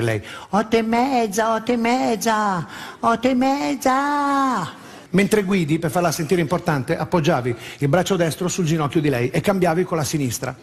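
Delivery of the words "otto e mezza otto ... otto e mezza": slow, stretched excited repeating; slow, sing-song excited girlfriend; giddy girlfriend. Retold or imitated. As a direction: savor each word like a thrilled date.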